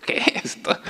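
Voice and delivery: breathy laughing whisper through tears; laughing at own tears. Real, not acted. Preacher laughing at his own tears, whispered. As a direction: laugh at yourself for crying again. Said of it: "de nuevo llorando".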